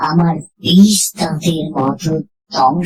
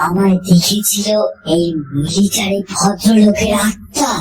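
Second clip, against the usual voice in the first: ordinary delivery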